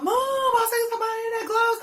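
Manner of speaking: high-pitched cry to mama; frantic child. Retold or imitated. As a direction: imitate a scared kid calling for mother. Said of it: "I was telling her".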